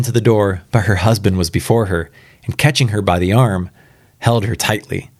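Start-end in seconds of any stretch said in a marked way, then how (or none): none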